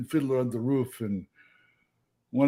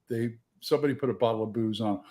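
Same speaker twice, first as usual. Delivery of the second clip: usual speaking voice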